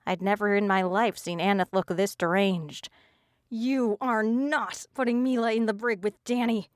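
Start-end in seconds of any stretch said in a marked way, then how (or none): 3.5-6.7 a touch higher, tight and seething; through gritted teeth. A narrator's reading. Friend seethes at Texas through gritted teeth. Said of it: "through gritted teeth".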